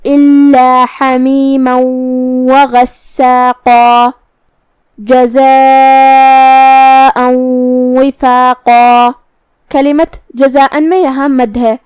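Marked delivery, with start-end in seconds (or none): 0.0-9.1 slow chant, جزاء held long and loud; tajweed recitation. Shown as a demonstration. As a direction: recite melodically, stretching the madd in جزاء. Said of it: "چار مد کے برابر لمبی کریں گے". (ترتیل سے تلاوت، جزاء کی مد لمبی کھینچ کر)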